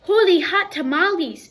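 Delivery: high, loud cry of amazement; excited exclaim. A narrator's reading. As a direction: burst out with delight.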